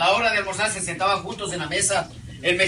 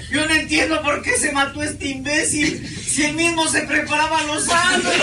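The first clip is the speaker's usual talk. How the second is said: high, slow, exasperated widow's voice; whiny Pasto widow; 6 semitones higher, slower than usual. Acted. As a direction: wail it up high and slow in disbelief. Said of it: "a moco tendido lloraba y decía". (viuda pastusa aguda, lenta y exasperada)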